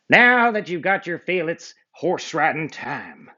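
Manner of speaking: high, hearty cowboy twang. Acted. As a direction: announce it like a trail boss. Cowboy Dan speaking.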